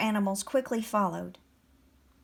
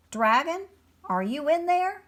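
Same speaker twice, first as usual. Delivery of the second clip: raised, higher calling voice; 7 semitones higher, 3 dB louder than usual. A narrator's reading. Bear calls to the hidden dragon, calling out. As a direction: call in to someone out of sight. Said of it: "a bear called out".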